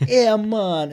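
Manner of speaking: put-on high, loud Jamaican accent.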